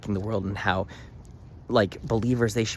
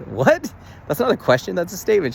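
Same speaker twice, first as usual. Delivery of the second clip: much higher and louder, incredulous; like the news floored her; stunned disbelief; 11 semitones higher, 7 dB louder than usual. Retold or imitated. Shocked reply to client offering her credit card, higher and louder.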